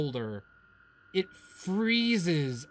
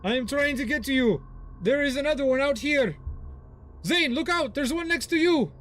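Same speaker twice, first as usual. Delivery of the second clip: urgent warning shout; 9 semitones higher than usual. Acted. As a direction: warn a friend of danger. Character calls warning.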